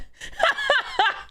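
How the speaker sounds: high helpless laugh-cry. Real, not acted. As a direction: give up and laugh through tears. Laugh after sad complaint.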